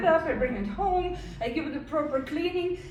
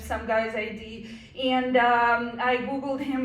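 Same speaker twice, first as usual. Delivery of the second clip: ordinary talk, nothing marked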